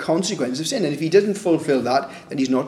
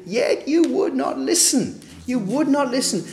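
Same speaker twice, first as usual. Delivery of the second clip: exasperated God; 10 semitones higher than usual. Acted. God lamenting his people's deafness, pained and high. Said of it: "he was saying the same thing".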